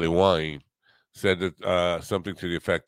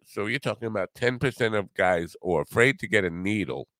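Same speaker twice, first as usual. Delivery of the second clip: normal talking voice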